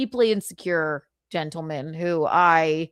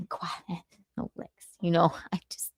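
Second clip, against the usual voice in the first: soft unvoiced whisper; whispered aside; 2 semitones lower, 7 dB quieter, faster than usual. Real, not acted. Whispering a joke about Quad to the chat. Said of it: "whispered aside".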